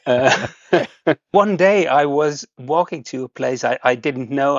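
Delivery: ordinary delivery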